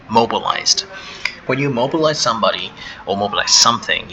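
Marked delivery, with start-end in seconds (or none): 0.1-0.8 mobilized with a clear final D sound; pronouncing mobilized. Shown as a demonstration. Teacher models mobilized for learners, clear final D. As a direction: say mobilized ending in a voiced D. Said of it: "the D is pronounced as D".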